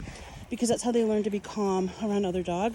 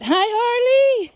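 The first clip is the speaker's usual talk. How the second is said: high, loud, slow sing-song hello; excited greeting; 9 dB louder, much slower than usual. Real, not acted. Owner greeting Harley at the gate, high and loud.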